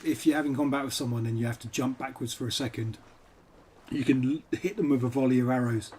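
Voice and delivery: usual speaking voice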